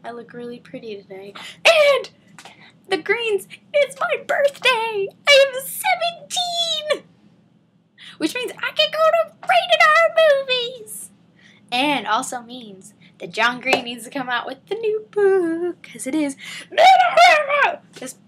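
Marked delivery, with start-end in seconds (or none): 1.6-11.1 very high, drawn-out birthday announcement; exultant shout. Real, not acted. 16.7-17.8 high, loud celebratory yell; excited shout. Real, not acted. Celebratory yell closing video.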